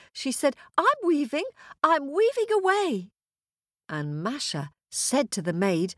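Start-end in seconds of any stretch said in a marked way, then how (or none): 0.8-3.1 high-pitched answer called back; dutiful little girl. Acted. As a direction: call back like an obedient niece. Girl answers witch, hiding fear.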